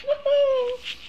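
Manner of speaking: high happy whoop; little cheer. Real, not acted. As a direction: cheer at the news. Cheering new comments.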